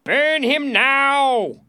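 high, slow, full-force yell; calling out. A narrator's reading. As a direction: demand it at the top of your voice. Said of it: "a voice called out".

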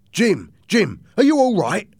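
relieved greeting. A narrator's reading. Captain greets Jim at door.

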